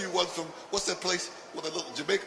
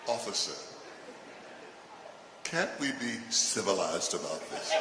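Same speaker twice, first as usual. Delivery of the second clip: whitest voice; 7 semitones lower, 7 dB quieter than usual. Pretend. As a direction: play it prim and proper for the cop. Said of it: "in your whitest voice".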